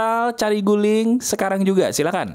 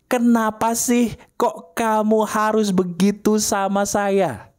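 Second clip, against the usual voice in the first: demo of the scream line; 6 semitones higher, slower than usual. Shown as a demonstration. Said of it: "Teriak di bantal atau guling tadi sekeras-kerasnya".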